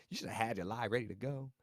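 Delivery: ordinary talk, nothing marked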